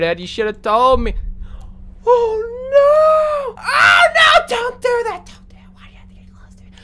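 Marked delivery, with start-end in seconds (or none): 2.0-4.5 high frightened cry; scared cry. Real, not acted. Monster approaching. 4.5-6.7 fast terrified whisper; scared whisper. Real, not acted. Monster approaching.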